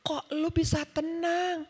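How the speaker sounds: high, slow, amazed retold question; imitating tearful guests. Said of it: "mereka nangis sampai mereka peluk saya".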